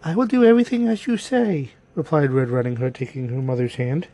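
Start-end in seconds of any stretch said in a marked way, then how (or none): 0.0-1.7 put-on higher-pitched little girl, obedient. A narrator's reading. Girl promising her mother to obey, high girlish voice. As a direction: answer as an obedient young girl.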